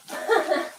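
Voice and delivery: a quick little laugh to round off the remark; short laugh. Real, not acted. Laughs after being called lazy.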